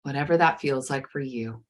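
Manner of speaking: soothing guided meditation. Acted. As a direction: ease the listener gently into calm.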